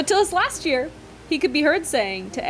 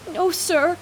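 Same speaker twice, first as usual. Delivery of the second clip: croaking; 6 semitones higher than usual. Acted. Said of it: "he croaked".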